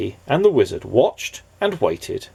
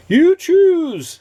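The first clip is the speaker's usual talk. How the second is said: much higher quavery wizard voice; jolly wizard; 6 dB louder than usual. Acted. The wizard asking Mister Nobody, high wizard voice. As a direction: ask like a fussy old wizard.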